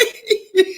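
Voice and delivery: short laugh. Real, not acted. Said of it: "I burst out and started laughing".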